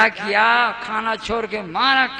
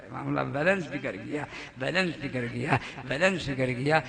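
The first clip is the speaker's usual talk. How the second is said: much lower, softer repetition; dropping voice; 9 semitones lower, 11 dB quieter than usual. Acted. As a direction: let it sink away. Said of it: "repeats, falling quiet".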